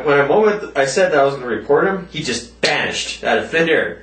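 usual speaking voice

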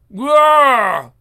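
loud beast roar. Acted. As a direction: roar like an attacking monster. Creature attacks.

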